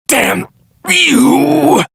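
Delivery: high, strained cry of rage; anguished curse. Acted. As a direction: spit the curse as you fall. Villain cursing as he loses.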